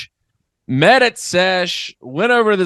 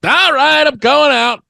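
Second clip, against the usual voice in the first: pumped up; 10 semitones higher, 5 dB louder than usual. Retold or imitated. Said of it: "I'm like".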